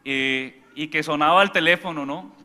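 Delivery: ordinary talk, nothing marked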